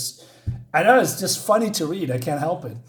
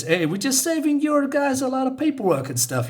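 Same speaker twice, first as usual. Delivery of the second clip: much higher, hammed-up excuse voice; placating cop; 9 semitones higher than usual. Cop excuses himself to the head honcho, high placating voice. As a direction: pitch it up high and plead innocence.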